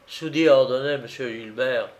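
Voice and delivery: usual speaking voice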